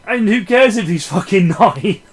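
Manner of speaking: laughing through the punchline; a laugh breaks into the line. Real, not acted. Reviewer cracking up at his home invasion joke, laughing.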